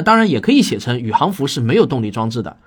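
ordinary talk, nothing marked

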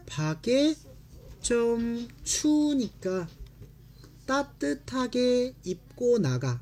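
slow, carefully split Korean phrases; slow read. Shown as a demonstration. Teacher models the mother's line slowly. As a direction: read it word by word for students. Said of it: "teacher reads sentence slowly".

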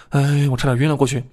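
ordinary delivery, nothing marked

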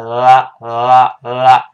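loud, thick "呃" with tongue tip stuck up; English L sound. Shown as a demonstration. Teacher showing the tongue position, loud thick L. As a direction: hold a heavy l behind the teeth. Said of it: "抬起来跟上牙膛粘一下".

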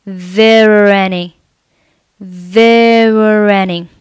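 "There any" drawn out through rolling linked r sounds; slow linking. Shown as a demonstration. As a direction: stretch "There any" slowly as one joined word. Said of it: "可以构成辅元连读".

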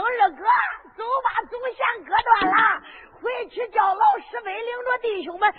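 loud, excited cry of success; joyful shout. Acted. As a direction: cheer the brother on. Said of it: "王能心中高兴". (高兴地大喊)

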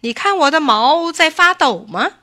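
high, loud, pompous question; fox as tiger. A narrator's reading. Fox quizzes the rabbit before attacking, self-important. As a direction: play the big hunter.

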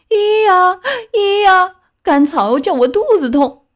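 high, loud donkey wail, hee-haw; braying donkey cry. A narrator's reading. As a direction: whine like a hurt donkey. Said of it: "回答说". (驴子高声哀叫)

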